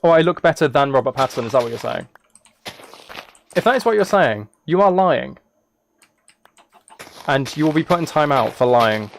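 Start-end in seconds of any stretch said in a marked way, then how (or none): none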